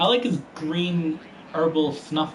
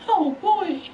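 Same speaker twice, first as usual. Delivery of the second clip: rising alarm; 13 semitones higher, 5 dB louder than usual. Real, not acted. Racer seeing only four to go, high-pitched alarm. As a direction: squeal it out in playful panic. Dismay at laps left, escalating.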